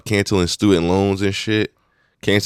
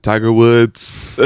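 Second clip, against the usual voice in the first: mock Trump; 3 semitones higher, 4 dB louder, much slower than usual. Quoting Trump, drawn out.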